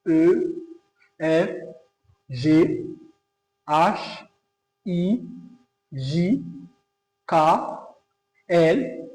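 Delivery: teacher's French alphabet drill. Shown as a demonstration. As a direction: repeat A to Z with French letter names, pausing after each. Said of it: "So I'll repeat for those".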